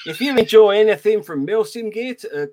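hammed-up attempt at Australian; bad Australian accent. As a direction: put on a poor Australian accent. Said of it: "how shit he is at Australian accents".

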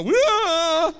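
mock rock cry. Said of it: "the rocks out there are gonna go".

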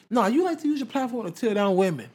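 high-pitched, pointed accusation; emphatic correction. Retold or imitated. Retelling Nicki correcting herself to women, emphatic high-pitched mock accusation. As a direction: raise the pitch and press the correction home.

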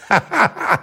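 a short high chuckle; amused chuckle. Real, not acted. Chuckling at his new word hornswoggled, amused chuckle. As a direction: laugh at your own find.

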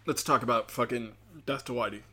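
ordinary talk, nothing marked

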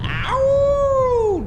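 long high howl; wolf howl. Acted. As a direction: howl like a werewolf. Werewolf howl.

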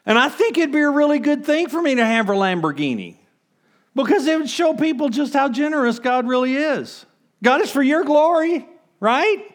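high, loud, hammed-up pious pleading; loud, high mock-earnest prayer; mock selfish reasoning. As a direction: play the greedy prayer straight-faced. Mocking selfish prayer for Lamborghini.

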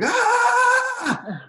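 high excited yelp of joy; imitating an excited shriek. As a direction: squeal like an overjoyed friend. Antonio greets him excitedly.

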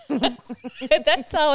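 high, laughing delivery; words breaking out through a laugh; real laughter. Real, not acted. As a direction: crack up while talking. Cracks up at own family joke.

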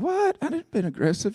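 high-pitched mock disbelief; incredulous protest. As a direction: start on a squeaked, disbelieving question. Retells disbelief at wife's remark.

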